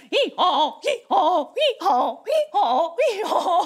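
silly, repeated donkey hee-haw; donkey bray. Pretend. Playing the donkey riding into town, silly bray. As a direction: bray along playfully, nearly laughing. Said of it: "What does a donkey say".

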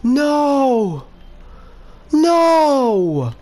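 high, loud wail of no; real panicked cry; desperate shout. Real, not acted. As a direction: scream it as it all goes wrong. Creeper about to blow.

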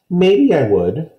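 slow, high reluctant concession; Kirsty considering, mild high grudging; grudging child. A narrator's reading. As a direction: give in slowly and grudgingly. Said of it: "she said finally".

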